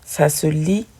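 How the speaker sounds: ordinary talk, nothing marked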